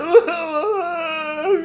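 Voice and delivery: mock wail. Mock toddler over spilt milk.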